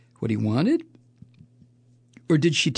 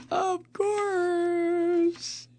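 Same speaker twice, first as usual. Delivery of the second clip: high, drawn-out mocking of course; sarcastic drawn-out; 5 dB quieter than usual. Real, not acted. Host mocking the news item, sarcastic.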